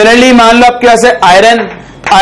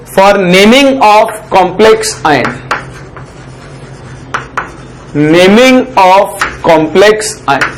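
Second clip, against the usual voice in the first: slow, word-by-word dictation; note dictation; 3 semitones lower, 3 dB quieter, much slower than usual. Shown as a demonstration. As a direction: read each word out slowly for students to write down. Teacher dictates heading slowly. (धीरे-धीरे notes लिखवाना)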